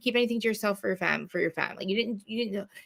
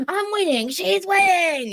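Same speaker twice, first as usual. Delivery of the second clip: higher, louder mock yell with a slow drag on the second winning; imitating a frantic yell; 10 semitones higher, 5 dB louder, slower than usual. Host imitating Teresa yelling on camera, loud and high. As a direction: ham up a screaming meltdown. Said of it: "literally on camera going".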